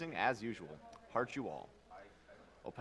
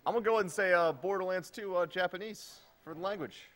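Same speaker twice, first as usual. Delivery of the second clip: excited announcement; 7 semitones higher, 7 dB louder than usual. Real, not acted. Hyped reader's choice pick.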